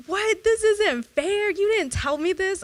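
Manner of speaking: high, loud, indignant little-brother complaint; whiny protest. Retold or imitated. Brother protesting the prank, high and indignant. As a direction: squeal it like a cheated kid. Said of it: "he was like".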